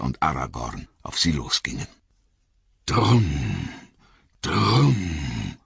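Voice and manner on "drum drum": drum sounds. A narrator's reading. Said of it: "machten die Trommeln in der Tiefe".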